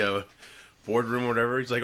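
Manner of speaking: usual speaking voice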